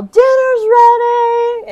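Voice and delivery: loud, high, slow dinner call; calling someone home. Retold or imitated. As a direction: yell it to every corner of the house. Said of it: "we would just yell".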